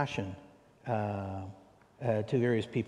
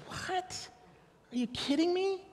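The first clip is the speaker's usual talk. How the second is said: high whispered what, then squeak; shocked disbelief; slower than usual. Retold or imitated. Preacher stunned by the man's story, high disbelief. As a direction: gasp it out in disbelief. Reacting to nobody marrying him.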